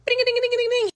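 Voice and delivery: high sing-song ding ding of a ringing call; phone ring imitation. Narrator imitates the call ringing, high ding-ding.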